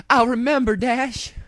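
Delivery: high, loud mare voice; cried out. A narrator's reading. Applejack crying out to Dash after the kiss, high. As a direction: burst out relieved. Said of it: "she cried".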